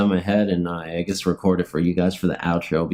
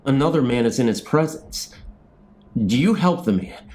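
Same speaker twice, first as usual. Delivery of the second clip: TV show narration; 4 semitones higher than usual. Acted. Vlogger narrating his made-up show outro, announcer style. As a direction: introduce the episode like a show host.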